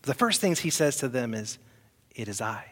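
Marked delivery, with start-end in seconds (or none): 2.1-2.6 softer, comforting reassurance; Jesus calming the frightened disciples, gently. Retold or imitated. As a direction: speak it like a calming friend. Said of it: "This comforting, calming voice".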